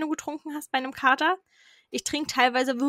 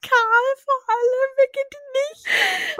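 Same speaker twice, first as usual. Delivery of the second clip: excited; 13 semitones higher, 4 dB louder, slower than usual. Acted. Mocking the winner reveal.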